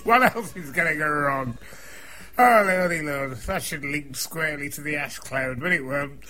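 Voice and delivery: usual speaking voice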